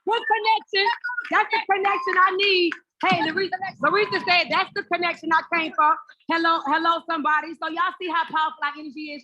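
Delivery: high, loud, gleeful praise; excited hype. Real, not acted. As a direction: whip the crowd up over the connection. Host hypes new connection.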